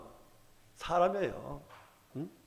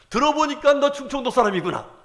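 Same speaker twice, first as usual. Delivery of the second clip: higher, louder gotcha accusation; triumphant accusation; 7 semitones higher, 8 dB louder than usual. Retold or imitated. Bystanders unmask Peter by his accent, loud triumphant accusation. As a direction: call him out loudly, certain you've caught him. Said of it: "이렇게 딱 얘기를 했더니".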